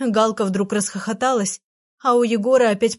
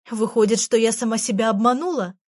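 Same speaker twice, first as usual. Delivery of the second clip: plain reading voice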